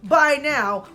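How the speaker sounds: ordinary delivery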